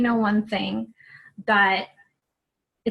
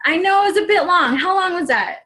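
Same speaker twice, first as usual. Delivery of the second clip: relieved burst; 8 semitones higher, 5 dB louder than usual. Real, not acted. Relieved after finishing the video.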